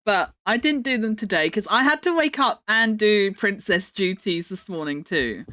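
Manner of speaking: ordinary delivery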